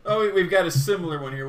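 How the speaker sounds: ordinary talk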